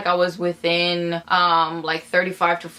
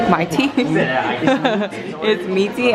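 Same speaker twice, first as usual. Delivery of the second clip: laughing; much slower than usual. Real, not acted. Laughing at name.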